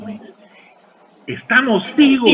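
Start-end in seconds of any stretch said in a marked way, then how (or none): 1.3-2.3 triumphant shout. Retold or imitated. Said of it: "toda la gente se puso a aplaudir y gritaba".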